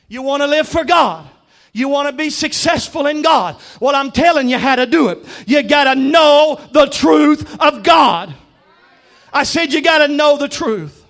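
high, rising-force exhortation to the church; emphatic preaching. Acted. Preacher telling believers how to live for God, emphatic preaching. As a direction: hammer it out phrase by phrase.

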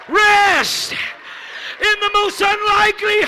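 loud, high, shouted preaching; preaching at full force. Acted. Preacher calling the congregation to dance and receive, shouting. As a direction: rouse the whole church to its feet.

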